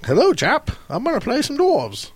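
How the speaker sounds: high, hammed-up English accent; playful fake British accent; mock English accent. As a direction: put on a silly posh Englishman. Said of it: "That is your best foreign accent you've ever done".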